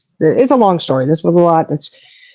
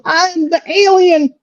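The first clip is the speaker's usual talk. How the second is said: put-on high, slow, beaming boy's voice; proud kid; 11 semitones higher, slower than usual. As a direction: announce it like the best role ever. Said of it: "he goes".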